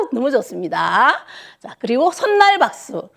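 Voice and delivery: ordinary delivery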